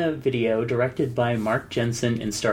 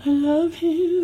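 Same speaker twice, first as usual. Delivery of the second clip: mock swoon; slower than usual. Mocking echo of partner's line.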